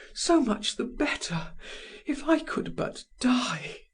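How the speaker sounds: high, weary woman's wish; a hint of wanting to die; lightly voiced despairing lover; mild despair. A narrator's reading. Held Madame Walter voice.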